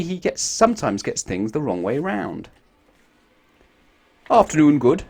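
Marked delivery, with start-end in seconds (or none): 4.3-5.0 loud, cheery muddled greeting; proud of his back-to-front words; Mister Topsy-Turvy. A narrator's reading. Mister Topsy-Turvy greeting the hotel manager, cheery and loud. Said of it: "said Mister Topsy-Turvy".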